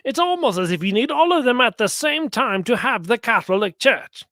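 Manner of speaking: usual speaking voice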